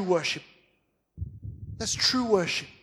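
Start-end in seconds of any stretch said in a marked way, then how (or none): none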